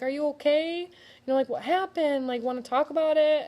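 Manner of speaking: put-on high, gentle voice of a worried kid; concerned child. Child comforting her crying mother, high and gentle. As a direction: lift the voice up like a caring little girl. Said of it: "and I was like".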